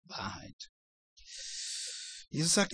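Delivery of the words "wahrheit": scornful Pilate, lower, fading to a whisper; mocking. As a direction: dismiss the word with a sneer, dying away. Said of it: "Ob er das spöttisch sagt". (spöttisch, tiefer, verklingend bis zum Flüstern)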